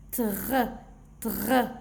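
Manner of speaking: "Tr" with a long throaty R; blended consonants. Shown as a demonstration. Teacher reading syllables for children, blended consonants. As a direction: sound out "Tr" as one blend. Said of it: "regardez comment je vais lire".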